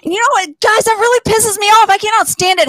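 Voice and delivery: mock Karen outrage. Comic playing a Karen to the crowd, mock outrage. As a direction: play an offended Karen losing her temper.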